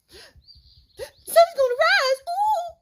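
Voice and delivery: high, slow squeaky squirrel voice; high piping squirrel with a cooing ooh. Acted. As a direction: pipe it up like a tiny squirrel. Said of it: "little brown squirrel piped up".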